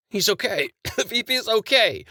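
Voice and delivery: high voice, coughing out words. Acted. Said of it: "one of the bodyguards coughed".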